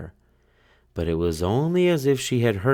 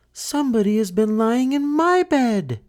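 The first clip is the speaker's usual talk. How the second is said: much higher mother bear voice; middle-sized bear voice; 3 dB louder than usual. Acted. Mother bear finding her bed disturbed, higher voice. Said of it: "in her middle-sized voice".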